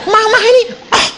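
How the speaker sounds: put-on high-pitched protesting child; a sleepy boy complaining to mum; whiny child. Child voiced waking in story. (anak merengek bernada tinggi)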